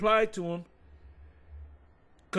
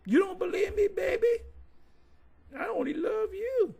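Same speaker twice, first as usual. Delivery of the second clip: mock seductive woman; 13 semitones higher than usual. Mocking the women who flatter Jake men.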